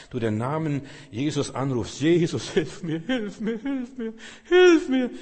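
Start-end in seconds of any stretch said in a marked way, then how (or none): 2.0-5.1 rising from pleading to high loud scream; building cry for help. Acted. Believer calling Jesus, building to a scream. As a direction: climb higher with each hilf mir.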